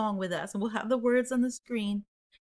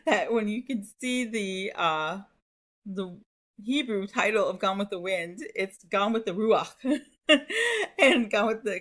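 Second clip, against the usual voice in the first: ordinary talk, nothing marked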